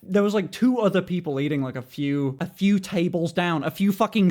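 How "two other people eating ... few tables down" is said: ordinary talk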